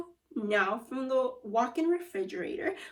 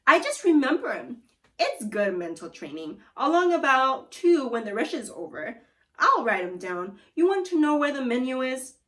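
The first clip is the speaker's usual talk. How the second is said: louder, proud wizard voice; booming wizard; 2 semitones higher, 6 dB louder than usual. Acted. Food wizard answering Gloria from the loudspeaker, booming. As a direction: boom it out like a showman. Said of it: "said the food wizard".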